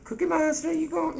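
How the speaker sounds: Edwina. Retold or imitated. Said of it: "she'd have a little talk with you about it, like".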